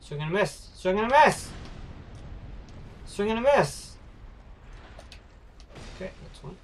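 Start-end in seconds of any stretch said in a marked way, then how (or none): 0.0-3.9 building louder and higher mock announcer cry; hammed-up play-by-play; sportscaster call. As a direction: call it like a baseball commentator, building up. Repeating the phrase as calls.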